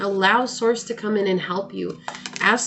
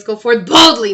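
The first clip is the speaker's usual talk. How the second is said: louder; 3 semitones higher, 10 dB louder than usual. Real, not acted. Energetic sign-off.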